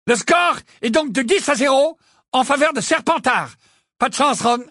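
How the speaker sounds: high-pitched score announcement; match announcer. A narrator's reading. Lee giving the score to the stadium, announcing high. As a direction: project the score to the stands.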